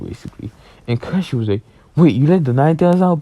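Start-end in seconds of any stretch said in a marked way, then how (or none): 1.9-3.2 raised, louder mock disbelief; retold alarm. Kakashi learns the Nine Tails is out, retold in loud alarm. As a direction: blurt it high and loud, imitating a stunned character.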